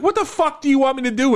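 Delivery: high-pitched mock panic; freaking out. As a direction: protest helplessly, pitch shooting up. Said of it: "like, freaking out at this point".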